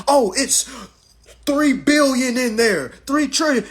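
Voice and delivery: high mocking voice of a bragging fool; mocking goofball. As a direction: squeal it out like a clueless show-off.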